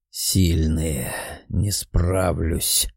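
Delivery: low, slow, sorrowful. A narrator's reading. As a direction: admit defeat with grief. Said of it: "с прискорбием подытожил парень".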